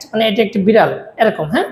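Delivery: usual speaking voice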